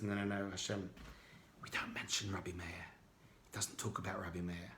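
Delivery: low, hushed and sorrowful; solemn and sad. Acted. Elijah telling the student solemnly, low and hushed. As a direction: break the bad news softly and gravely. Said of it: "goes a bit solemn-faced and looks a bit sad".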